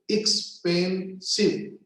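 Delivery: "Expensive" slowly broken as ex-pen-sive; split in three syllables. Shown as a demonstration. Teacher counting syllables for a table, broken in three. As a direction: say "Expensive" in three separate slow beats. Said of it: "three syllables".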